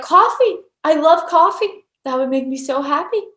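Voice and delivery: high, loud burst of glee, easing off; delighted. Retold or imitated. Retelling her morning answer about coffee, delighted. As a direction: exclaim like it's the best idea ever.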